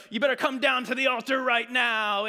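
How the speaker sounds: loud, high mock altar call; pressuring preacher. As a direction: imitate a preacher piling on the pressure. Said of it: "it was like all the pressure".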